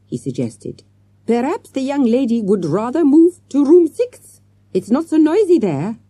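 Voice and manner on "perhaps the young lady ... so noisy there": high, loud, helpful manager voice; French hotel manager. A narrator's reading. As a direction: make the suggestion as an eager-to-please manager.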